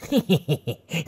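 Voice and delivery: leering "khà khà" laugh; gloating stage-villain chuckle; villain's chuckle. A narrator's reading. Lecher laughing at the struggling captive, gloating. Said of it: "Gã cười khà khà".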